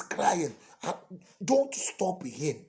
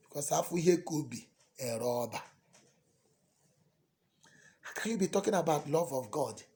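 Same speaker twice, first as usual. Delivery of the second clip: ordinary talk, nothing marked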